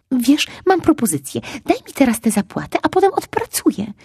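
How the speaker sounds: high, loud eager pitch; eager, persuasive. Acted. Fox eagerly tries to persuade the squirrel, high and loud. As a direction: talk her into it, all excitement. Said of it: "mówił z przejęciem i bardzo przekonująco".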